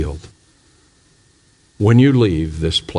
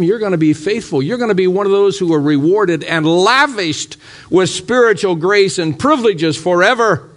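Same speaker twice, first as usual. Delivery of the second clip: preaching at full force; 9 semitones higher than usual. Acted. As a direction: drive the altar call home, full fervour. Preaching climax, high and loud.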